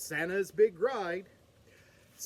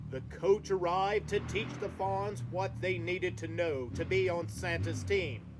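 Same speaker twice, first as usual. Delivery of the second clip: plain reading voice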